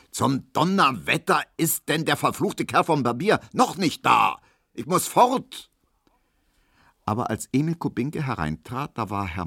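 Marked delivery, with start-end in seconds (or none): 0.1-5.7 angry, high-pitched bellow; cursing, fuming shout. A narrator's reading. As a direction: roar impatiently from the next room. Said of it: "hörte man Herrn Markowski brüllen".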